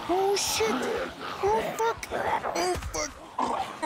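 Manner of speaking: high, panicked curse at a sudden scare; startled panic. Real, not acted. Gamer cursing at a sudden zombie. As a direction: yelp it out in shock.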